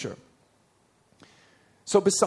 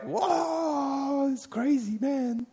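high, awed surfer-dude voice; amazed onlooker; 10 semitones higher, much slower than usual. Retold or imitated. Crowd of listeners reacting to Paul, in high amazed voice. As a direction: ham up a stunned bystander. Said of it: "everyone's like".

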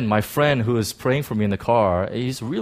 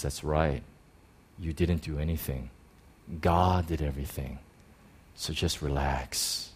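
calm reassurance; 8 semitones lower, 9 dB quieter than usual. Retold or imitated. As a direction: settle him down gently. Friend calms him on phone.

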